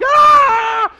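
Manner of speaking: high, loud wail imitating a hurt toddler; mock toddler cry, loud and high; a hammed-up baby wail, loud and high; crying toddler yell. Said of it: "He's like".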